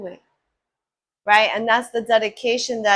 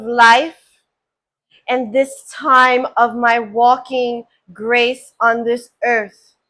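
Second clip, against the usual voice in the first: spoken prayer; 4 dB louder than usual. Real, not acted. Healer honours the blessings of ancestors, in deliberate prayer. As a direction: declare it solemnly, pausing between phrases.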